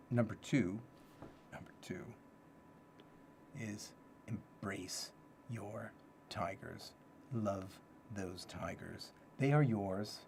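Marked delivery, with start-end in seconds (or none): 1.5-2.0 breathy whispered echo of the heading. Shown as a demonstration. Echoing his own list heading in a whisper. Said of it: "repeats it in a whisper". 3.6-9.1 slow emphasis. Shown as a demonstration. Savoring the list point slowly.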